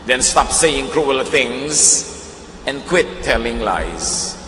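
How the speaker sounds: slow, measured Bible reading; Scripture reading. Acted. Preacher reading First Peter to listeners, slow solemn reading. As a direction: read the verse out slowly and deliberately. Reads Scripture aloud in English.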